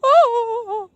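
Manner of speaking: very high, drawn-out wail; comic high-pitched howl of a scared snake; snake's cry. Pretend. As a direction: let out a long, shrill mock cry. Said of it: "And the snake goes".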